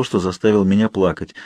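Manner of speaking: ordinary delivery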